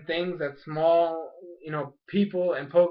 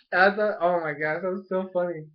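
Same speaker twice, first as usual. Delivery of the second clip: ordinary delivery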